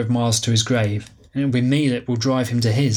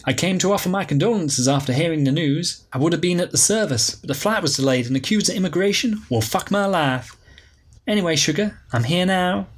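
higher, breezy flamboyant woman; Alicia, breezy diva; 5 semitones higher than usual. Acted. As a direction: sweep in like a glamorous old flame. Alicia's voice, held role.

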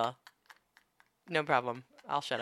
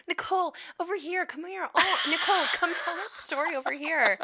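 calling out; 9 semitones higher than usual. Retold or imitated. Imitating partygoers calling her.

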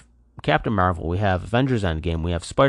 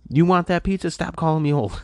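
mock threat; 9 semitones higher than usual. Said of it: "I'm like".

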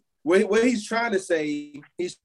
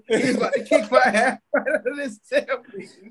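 laughing; 7 semitones higher than usual. Real, not acted. Joking about his demotion, laughing.

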